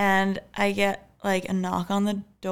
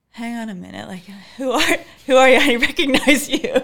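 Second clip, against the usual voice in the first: startled questioning; 3 semitones higher, 8 dB louder than usual. Retold or imitated. Confronting stranger, laughing.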